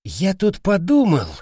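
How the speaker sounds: high-pitched, tentative opening; lightly voiced hesitant soldier. A narrator's reading. Silent soldier speaks up unexpectedly to the group, tentative. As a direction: pipe up unexpectedly with a thought. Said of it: "неожиданно подал голос".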